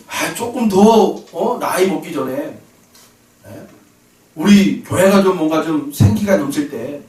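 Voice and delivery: usual speaking voice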